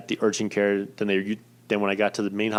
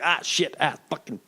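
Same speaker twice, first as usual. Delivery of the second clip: acted-out cursing, pitched up; pained cursing; 6 semitones higher than usual. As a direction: replay the yelps of swearing at a jab. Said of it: "and I'm, like, cursing".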